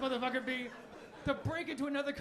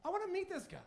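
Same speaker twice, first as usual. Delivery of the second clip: mock curious; 8 semitones higher than usual. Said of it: "And I was like".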